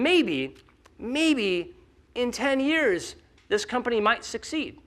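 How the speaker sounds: slow, doubtful dangling of a far-off chance; drawn-out hedging. Acted. Speaker voices a VC weighing a distant payout, drawn-out hedging. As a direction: stretch each word slowly, pitch climbing.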